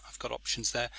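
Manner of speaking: normal talking voice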